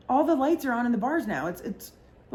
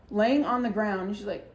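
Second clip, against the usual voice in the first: usual speaking voice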